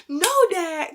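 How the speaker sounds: mildly exasperated plea to dad; acted-out protest. Retells her protest to dad.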